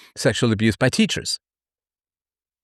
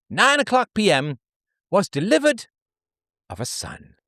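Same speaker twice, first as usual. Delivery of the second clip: much higher, prim and dutiful voice; pioneer woman reading her diary; 9 semitones higher than usual. Acted. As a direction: read like a hardworking frontier wife. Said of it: "diary entry of a pioneer woman".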